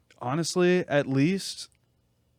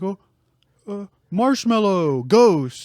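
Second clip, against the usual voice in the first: mock cooing owner; 5 semitones higher, 6 dB louder, much slower than usual. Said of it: "They're like".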